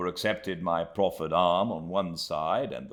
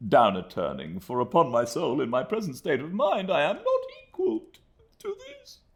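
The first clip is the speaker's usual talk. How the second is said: breaking down; 12 semitones higher, 4 dB louder than usual. A narrator's reading. Micawber to Copperfield, voice breaking high. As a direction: voice cracking high, half-laughing.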